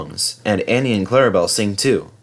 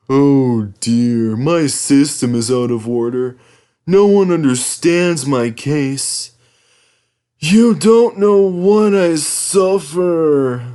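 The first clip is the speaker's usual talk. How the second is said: slow put-on moaning engine; 4 semitones higher, 3 dB louder, much slower than usual. Henry moans to Thomas about his suffering. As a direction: moan self-pityingly, dragging the words. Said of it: "moaned Henry".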